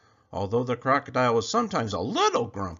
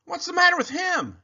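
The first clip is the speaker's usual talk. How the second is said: much higher, louder small-animal voice; a curious deer asking; worried deer peering at the crocodile; 6 dB louder than usual. A narrator's reading. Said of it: "asked a deer".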